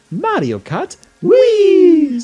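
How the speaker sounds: very high, loud, drawn-out shout; game title announcement. Retold or imitated. Imitating the audio from a game quiz, shrieked title cry. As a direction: belt it slowly, holding the last word. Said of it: "We hear".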